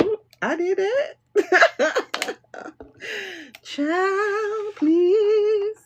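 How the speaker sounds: high, slow cringing squeal; embarrassed past self. Retold or imitated. Reader mocking her younger self, high and slow. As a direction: squeal at your own old mistake.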